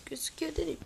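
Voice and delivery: whispered self-correction under the breath; hushed muttering to himself. Real, not acted. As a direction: correct yourself in a quick whisper. Muttered self-correction while playing.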